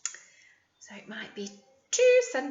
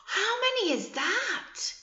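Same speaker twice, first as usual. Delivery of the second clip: sing-song question; 10 semitones higher than usual. Acted. Teacher quizzing young children.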